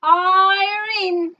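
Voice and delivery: high, loud called-out name; calling out. Acted. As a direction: call the name across the hall.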